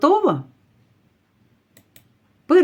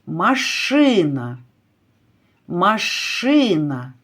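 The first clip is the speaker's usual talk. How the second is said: машина with the middle vowel И stretched long; stress on second syllable; slower than usual. Shown as a demonstration. Teacher helps pupils find the stressed vowel in машина, stretching И. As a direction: lean slowly on the И in машина. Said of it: "який наголошений в слові".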